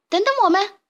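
high, loud cry from behind; high call of a little chick left behind; shouting after him. Acted. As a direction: yell for them to wait. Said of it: "卡门在后面大喊".

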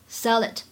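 "sell it" joined as one word; linked sounds. Shown as a demonstration. Teacher showing learners the linking in "sell it", linked. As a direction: carry the l of "sell" into "it". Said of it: "当中可以做连读".

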